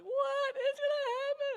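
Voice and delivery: high, loud, slow panicked wail; panicked cry. Retold or imitated. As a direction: cry it out in sudden fear. And I'm crying.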